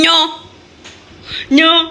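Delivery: usual speaking voice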